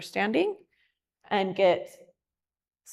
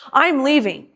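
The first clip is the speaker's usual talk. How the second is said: "I'm leaving" said louder, like a storming exit; heated "I'm leaving"; 4 semitones higher, 5 dB louder than usual. Shown as a demonstration. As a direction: snap "I'm leaving" in anger. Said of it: "then we're in the drama triangle".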